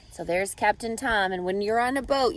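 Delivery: ordinary delivery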